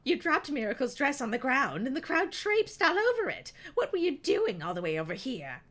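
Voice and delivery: loud scolding mother; angry mother. A narrator's reading. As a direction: let her have it, loud and shrill.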